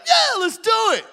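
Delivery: high, loud mock shout of agreement; mock rowdy yes from the band; hyped bandmates. Bandmates' retold hyped reply.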